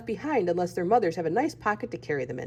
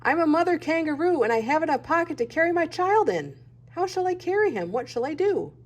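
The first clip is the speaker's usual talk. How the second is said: higher and calling out to a deaf owl; shouting up; 7 semitones higher than usual. A narrator's reading. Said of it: "screamed at him".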